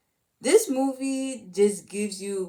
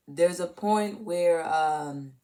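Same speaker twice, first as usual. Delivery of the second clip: ordinary talk, nothing marked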